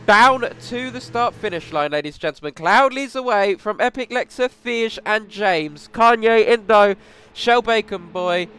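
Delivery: loud rising race-start call; motorsport commentator. Acted. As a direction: build up hype for the start.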